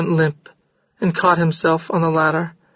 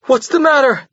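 higher, raised frantic question; shouted; 8 semitones higher than usual. A narrator's reading. Jorgis shouting at Maria in panic. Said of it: "he shouted".

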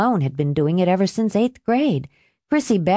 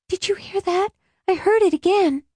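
nervous; 13 semitones higher than usual. Acted. She hears a noise.

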